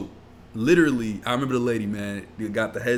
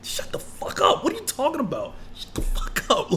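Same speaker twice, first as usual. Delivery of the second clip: half-whispered mock fury. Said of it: "I was like".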